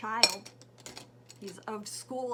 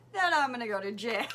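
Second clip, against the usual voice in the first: laughing; 4 semitones higher, 10 dB louder, faster than usual. Real, not acted. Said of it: "laughs through the line".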